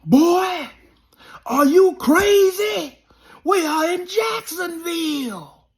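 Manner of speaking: high panicked coach yelling. Retold or imitated. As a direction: shriek at the player like a scared coach. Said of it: "my coach ... said".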